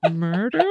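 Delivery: "Murder?" with a rising question at the end. Acted. Injured man mouths a word to the woman, questioning. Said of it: "with a question mark at the end".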